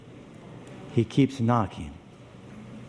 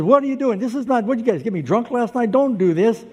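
panicked guard; 8 semitones higher, 4 dB louder than usual. Acted. Guard panics at missing prisoner.

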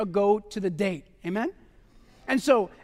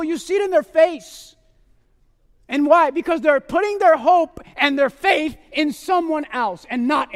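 fervent preaching; 4 semitones higher, 4 dB louder than usual. Acted. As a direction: press the congregation with earnest conviction. Preaching the application to the congregation.

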